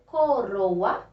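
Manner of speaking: "coroa" broken into clear syllables with a tapped R; teacher carefully demonstrating "coroa" with a weak R; tongue-tip weak R. Shown as a demonstration. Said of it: "eu estou pronunciando o ró".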